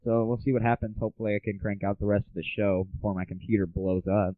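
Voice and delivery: ordinary talk, nothing marked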